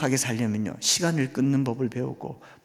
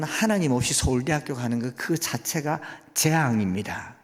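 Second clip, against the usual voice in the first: ordinary delivery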